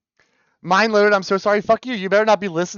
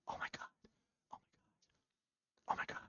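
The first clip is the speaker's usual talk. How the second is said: breathless whispered oh my God; 23 dB quieter, much faster than usual. Real, not acted. Streamer hearing the new album, whispered awe. Stunned while listening.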